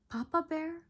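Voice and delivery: ordinary delivery, nothing marked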